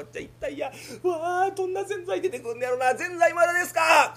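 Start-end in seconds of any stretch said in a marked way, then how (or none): 2.9-4.1 high, loud call for the order; impatient shout. Acted. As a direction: holler for your food. Impatient customer calling.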